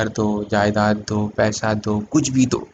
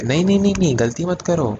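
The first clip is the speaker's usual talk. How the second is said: urgent correction; 6 semitones higher, faster than usual. Acted. Stops the imagined student's mistake.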